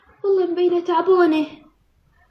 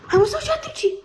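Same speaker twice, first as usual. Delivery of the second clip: pitched well up, cooing; 8 semitones higher, much faster than usual. Real, not acted. To the crying pet, a high cooing question.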